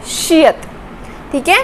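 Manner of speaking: usual speaking voice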